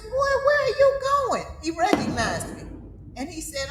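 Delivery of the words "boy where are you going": much higher, louder startled cry; Boy! Where are you going?, shocked; shocked call. Retold or imitated. Aunt calling out to drenched cousin on roadside, shocked and high.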